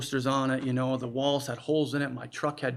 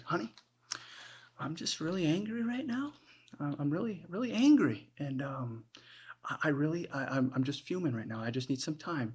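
quietly acted fuming, carefully held in; anger at half voice; 3 semitones higher, 5 dB quieter than usual. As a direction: act furious while keeping the voice down. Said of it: "lower your voice half".

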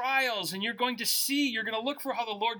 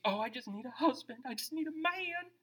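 higher, softer mock sigh of a young woman; lightly mocking voice of a single girl; mocking young lady; 5 semitones higher, 6 dB quieter than usual. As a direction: parody a lovesick girl. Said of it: "Young ladies".

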